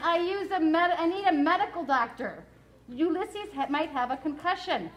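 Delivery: insist, pushing for help; urgent girl. Acted.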